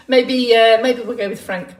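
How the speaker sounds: normal talking voice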